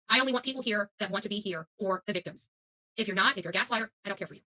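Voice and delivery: ordinary talk, nothing marked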